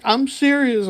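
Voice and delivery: high-pitched imitation of a tearful wife; imitating crying wife. Retelling wife confirming she is pregnant, mock tearful. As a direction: voice her high, without real sobbing. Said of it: "she's crying".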